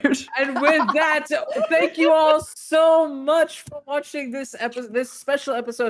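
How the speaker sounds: loud, excited host sign-off. Real, not acted. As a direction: boom it out with delight. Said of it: "I'm so excited".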